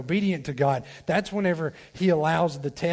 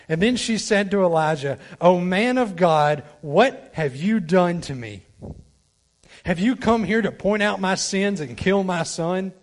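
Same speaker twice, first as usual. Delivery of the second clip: dramatic scripture reading; 3 semitones higher, 5 dB louder than usual. Acted. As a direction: read it as a grieving mother's cry.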